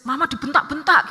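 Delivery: retold indignant protest, high and loud; aggrieved complaint. Mother telling older child about being shouted at, aggrieved. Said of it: "Mama kesal sekali sama adik".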